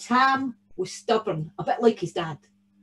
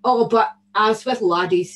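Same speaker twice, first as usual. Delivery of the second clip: usual speaking voice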